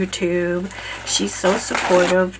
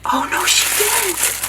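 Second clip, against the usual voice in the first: high, slow, squealing "oh no, she didn't"; delighted disbelief; 12 semitones higher, slower than usual. Real, not acted. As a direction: stretch it out in thrilled disbelief. Opening a surprise gift.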